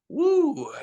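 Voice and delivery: loud, high-pitched "woo"; high whoop. Retold or imitated. Man reacting to life after lockdown, loud high whoo.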